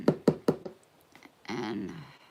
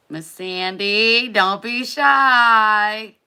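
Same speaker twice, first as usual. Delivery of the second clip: louder and higher, slow drawn-out call; coaxing the shy winner in chat; 6 semitones higher, 17 dB louder, much slower than usual. Real, not acted. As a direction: coax her out loud and slow.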